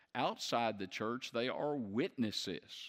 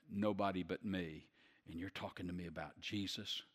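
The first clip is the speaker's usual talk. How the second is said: lower, much softer voice of a strict Torah believer; 4 semitones lower, 5 dB quieter than usual. Acted.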